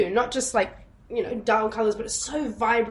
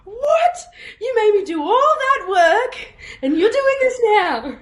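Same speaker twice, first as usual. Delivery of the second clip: high-pitched mock-indignant squawk; retold outrage; 10 semitones higher, 4 dB louder than usual. Mum learning all that work was needless, mock outraged. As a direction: imitate an incredulous mum, laughing underneath.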